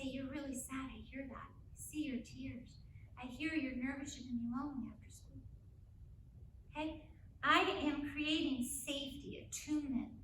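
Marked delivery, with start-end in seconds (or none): 0.0-5.4 soft validating. Shown as a demonstration. Mother soothing her grieving daughter, hushed and tender. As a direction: comfort her quietly. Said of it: "I say".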